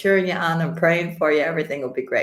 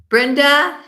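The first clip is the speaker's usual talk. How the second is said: excited greeting; 10 semitones higher, 10 dB louder than usual. Real, not acted.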